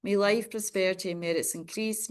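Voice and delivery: usual speaking voice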